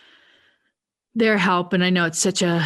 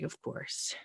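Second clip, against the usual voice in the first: breathy whispered "Of course"; 16 dB quieter than usual. Retold or imitated. Daughter muttering after her dad is released without her, whispered. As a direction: mutter it under your breath in disbelief.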